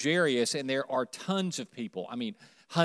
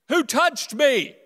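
slow, higher, louder call to the crowd; 10 semitones higher, 7 dB louder, slower than usual. Acted. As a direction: call it out over a crowd, drawn out. Jesus calling out to the crowd.

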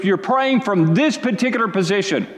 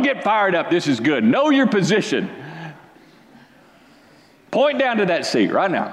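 ordinary talk

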